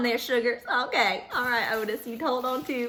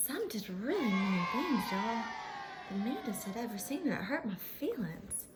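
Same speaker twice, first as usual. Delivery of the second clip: much softer, wounded; hurt, quiet; 4 semitones lower, 5 dB quieter than usual. Acted. Pony telling kids the mean fans hurt her, quiet and sad. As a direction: let the hurt show quietly.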